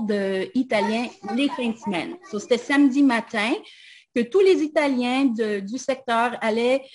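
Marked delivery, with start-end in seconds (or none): none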